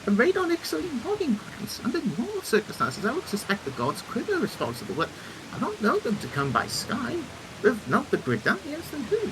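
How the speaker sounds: concerned, musing engineer; puzzled engineer character. Acted. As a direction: play an inventor reasoning out a mystery. Voices engineer puzzling over attack.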